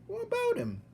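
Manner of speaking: very high puzzled question. Acted. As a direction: ask bluntly.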